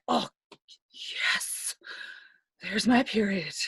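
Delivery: hushed, relieved sigh on "Oh, yes", then settling into voice. Acted. Telling friends it's sometimes a relief, whispered relieved yes. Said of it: "The best and worst feeling".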